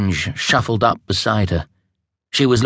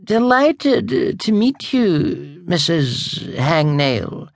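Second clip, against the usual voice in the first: high, slow, strained courtesy; forced politeness; 10 semitones higher, slower than usual. Acted. Girl forcing a gracious greeting to an unwelcome witch, high and strained. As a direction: greet her like it hurts to be polite. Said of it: "said as gracefully as she was able".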